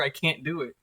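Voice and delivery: ordinary talk, nothing marked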